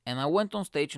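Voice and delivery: ordinary talk, nothing marked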